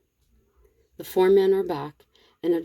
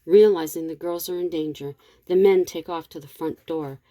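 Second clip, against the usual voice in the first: usual speaking voice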